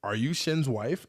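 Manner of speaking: asked again slowly and deliberately; drawn-out second ask at the door; slow repeated question. Retold or imitated. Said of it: "And then, like, I was like".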